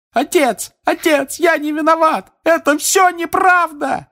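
very high, loud terrified shout; desperate screaming. Acted. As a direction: scream your innocence as you're dragged out. Son dragged away screaming.

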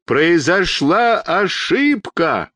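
slow, high, drawn-out call; long-distance acted holler; desperate shout. A narrator's reading. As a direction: stretch every word as if through cupped hands. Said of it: "закричал изо всех сил".

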